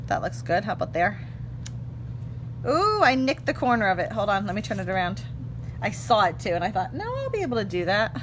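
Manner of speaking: ordinary talk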